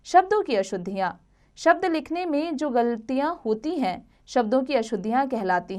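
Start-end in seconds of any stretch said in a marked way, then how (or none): none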